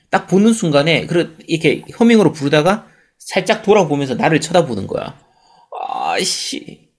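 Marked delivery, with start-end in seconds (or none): 5.9-6.9 long, low, drawn-out swoon; swooning sigh. Real, not acted. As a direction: melt over the memory. Swooning over game heroine.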